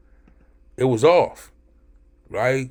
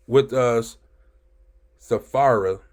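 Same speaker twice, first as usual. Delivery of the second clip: usual speaking voice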